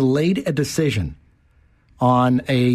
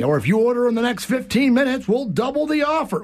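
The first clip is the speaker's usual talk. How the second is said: high, eager sales pitch; over eager infomercial; 10 semitones higher than usual. Pretend. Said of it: "Yeah, exactly".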